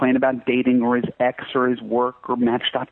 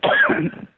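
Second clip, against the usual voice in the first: clearing throat. Real, not acted. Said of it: "I'm choking here".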